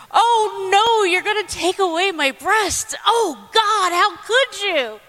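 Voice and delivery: high, hammed-up distress, slowing at the end; over-the-top mock despair; mock dramatic wail. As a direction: play the tragic patient for laughs. Mock reaction to losing breasts.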